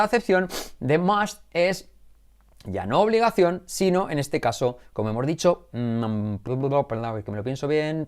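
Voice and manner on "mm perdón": ordinary talk, nothing marked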